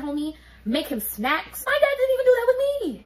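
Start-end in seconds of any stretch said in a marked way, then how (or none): 1.7-3.0 high, fast, louder disbelief; indignant outburst. Real, not acted. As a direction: blurt it out, outraged.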